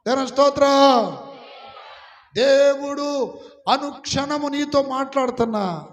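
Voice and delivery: fiery sermon climax, rising and loud; preaching at full force. Acted. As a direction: drive the rebuke home to the congregation.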